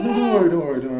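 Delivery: crying. Acted. Comforting a sobbing man.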